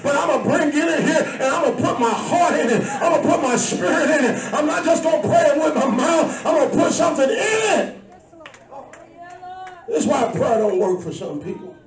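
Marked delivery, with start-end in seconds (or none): 0.0-8.0 fiery preacher at the peak of the sermon; full-force preaching. Acted. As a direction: whip the church up, voice rising to a cry.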